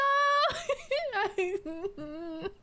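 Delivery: high-pitched giggling; high giggle fit. Real, not acted. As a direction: dissolve into giggles. Laughing fit after crack.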